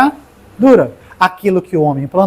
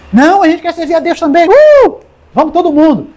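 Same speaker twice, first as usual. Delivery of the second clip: high, excited voice of the people with a cheering whoop; an excited congregation voicing its agreement; eager crowd answering; 10 semitones higher, 4 dB louder than usual. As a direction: play the eager crowd shouting yes. People answering Josué in a story.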